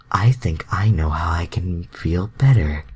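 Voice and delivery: scheming whisper; hushed, plotting whisper. Acted. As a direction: breathe it out as a sly plan. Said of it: "Rogue idolized".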